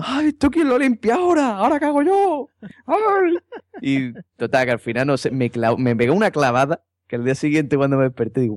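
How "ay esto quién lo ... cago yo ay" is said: high-pitched screeching complaint, much higher; angry taxi driver yell. Retold or imitated. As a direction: shriek at the passenger who made a mess. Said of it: "Y el taxista gritándome". (grito agudo de taxista indignado)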